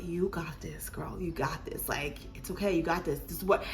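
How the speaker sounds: soft whispered reassurance; whispered encouragement. Retold or imitated. Nurse whispering encouragement to the mother in labor. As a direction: breathe it to her gently.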